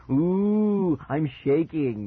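loud, high drawn-out "ooh"; mocking snort. Brother scoffs at sibling's threat, mockingly drawn out. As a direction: feign fear with stretched sarcasm. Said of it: "Mickey snorted".

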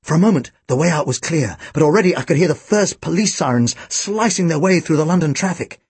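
plain reading voice

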